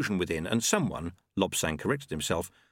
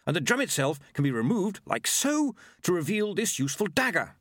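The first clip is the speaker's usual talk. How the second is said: higher, keen inventor's patter; 9 semitones higher than usual. Acted. Monk revealing a dagger in the drum, keen. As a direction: proudly demonstrate as the gadget monk.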